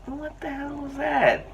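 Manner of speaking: high, slow, squeaky disbelief; incredulous. Retold or imitated. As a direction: squeal it out like you can't believe what you saw. Retells reaction to his cousin.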